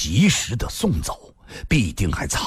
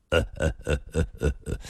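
soft, low staccato chuckle; dry mock laugh, pulse by pulse; 6 semitones lower, 6 dB quieter, faster than usual. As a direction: chuckle knowingly in short separate pulses. Villainous chuckle spelt as syllables.

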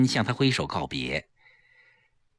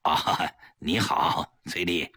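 low, slow strained hello; reluctant politeness, pitched down; forced cheer; 9 semitones lower, 4 dB quieter than usual. A narrator's reading. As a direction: greet through a forced smile. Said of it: "强打笑脸和崔丽打招呼".

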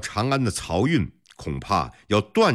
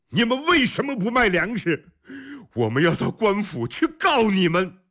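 high-pitched angry protest; agitated yelling; 13 semitones higher than usual. A narrator's reading. Desperate townsperson threatening to report the grain shop, yelling. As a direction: clamour in outrage, voice pitched high. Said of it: "百姓们急了， 有人嚷着".

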